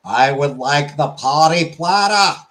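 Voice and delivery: slow Boston accent, rising to the end. Shown as a demonstration. Streamer retrying the party platter order, slow Boston accent. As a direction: stretch it out like a Bostonian. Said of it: "the Boston accent".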